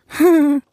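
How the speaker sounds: high woozy giggle; giddy laugh. Acted. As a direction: laugh it off dizzily. Larry laughs.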